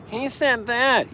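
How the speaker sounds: a scandalized commenter; mocking viewers. Viewers reacting to polarizing topics, high slow mock voice. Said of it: "They're like".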